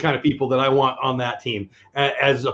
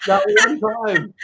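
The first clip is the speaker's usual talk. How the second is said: joking aside; slower than usual. Pretend.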